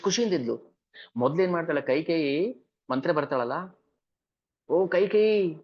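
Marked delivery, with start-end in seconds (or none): 4.7-5.6 higher excited announcing; Manthara's news. Acted. Manthara telling Kaikeyi of the coronation. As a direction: call out the big news.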